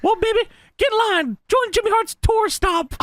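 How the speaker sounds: high, brash manager promo voice; shrill gloating wrestling manager. Retold or imitated. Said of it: "On the Mouth of the South".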